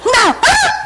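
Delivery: high shouted name and startled reply, acted out; calling out. Friend calls her at flea market for photos, calling out. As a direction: call across a market, then answer.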